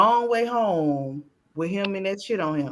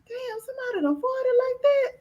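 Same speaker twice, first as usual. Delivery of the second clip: disgusted surprise. Retold or imitated. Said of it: "I'm like".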